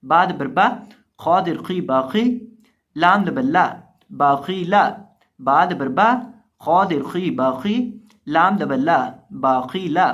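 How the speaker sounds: loud, chanted letter-by-letter drill; Quran spelling recitation. Acted. Teacher chanting the letters so students repeat.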